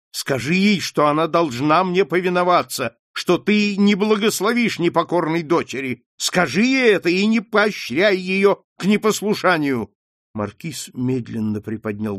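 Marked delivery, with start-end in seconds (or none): 0.1-9.9 higher, insistent command; harsh demand. Acted. Wife demanding her husband back her up, harsh and insistent. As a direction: order him, pressing every phrase.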